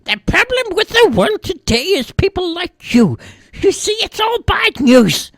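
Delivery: put-on very high, loud old-lady rant; scolding old lady. A narrator's reading. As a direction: tell off the TV like an indignant granny.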